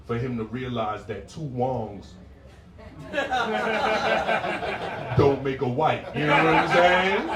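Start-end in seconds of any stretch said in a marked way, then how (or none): 2.2-5.1 bursting laugh at his own joke; cracking up. Real, not acted. 5.2-7.3 talking through laughter, louder; laughing through the tag line. Real, not acted. Punchline said while laughing.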